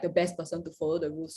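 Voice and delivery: ordinary talk, nothing marked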